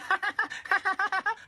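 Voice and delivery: high put-on mocking cackle; mocking laugh. Imitating the ex laughing, high mocking laugh. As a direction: laugh at her like the ex did. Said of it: "So she's laughing".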